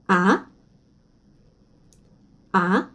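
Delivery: ah rising like a question; second tone. Shown as a demonstration. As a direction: slide ah sharply upward. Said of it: "Ton deux, question". (deuxième ton, ah montant comme une question)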